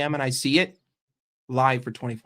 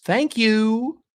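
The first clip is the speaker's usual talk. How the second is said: sarcastic thanks; 8 semitones higher, 4 dB louder than usual. Real, not acted. Sarcastic thanks after news.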